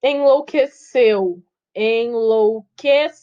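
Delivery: slow, counted-out "Enlouqueceu"; syllable by syllable. Shown as a demonstration. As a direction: spell out "Enlouqueceu" one drawn-out syllable at a time. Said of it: "sílaba por sílaba".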